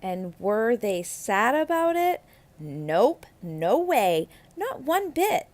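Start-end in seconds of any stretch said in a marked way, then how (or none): none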